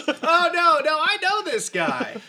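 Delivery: high, loud eager calling; excited hero. Acted. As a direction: wave a friend over eagerly. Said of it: "he'll say".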